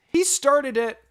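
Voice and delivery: put-on high, loud childish blame; kids yelling. A narrator's reading. Two boys pointing at each other, high yell. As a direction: shout it like a cornered boy.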